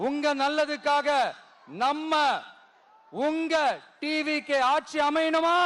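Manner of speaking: ringing political speech with long-held line endings; rally oratory. Real, not acted. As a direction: rouse a huge crowd, pausing for cheers. (மேடைப் பேச்சு, முழக்கமாக)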